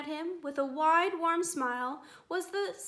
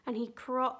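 ordinary delivery, nothing marked

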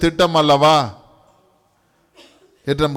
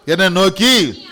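solemn reading; 5 semitones higher, 5 dB louder, slower than usual. Real, not acted. Slow solemn scripture reading.

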